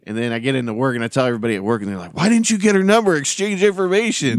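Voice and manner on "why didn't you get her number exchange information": teasing coworkers. Retold or imitated. Coworkers teasing about the girl.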